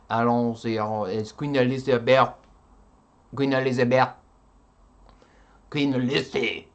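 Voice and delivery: loud, hammed-up mock French; full-on French accent. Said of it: "becoming full on French".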